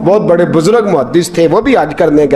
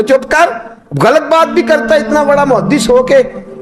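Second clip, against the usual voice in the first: irritated pilgrim; 8 semitones higher than usual. Retold or imitated. Pilgrim silences him.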